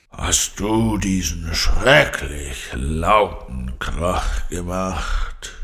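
slow, low growling lion; annoyed growl. A narrator's reading. Annoyed lion scolds Carla for the noise, slow growl. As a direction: growl it out slowly, thoroughly displeased. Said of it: "knurrte der Löwe verärgert".

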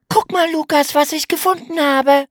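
hohe, lautere Mädchenstimme voller Aufregung; aufgeregt. A narrator's reading. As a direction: ganz aufgeregt, viel höher gesprochen. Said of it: "Aufgeregt winkte Emma". (Mädchen ruft den Bruder aufgeregt herbei, hoch und lauter)

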